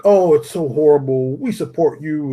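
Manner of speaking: imitating overly supportive followers; mock sympathy. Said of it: "all her followers are like".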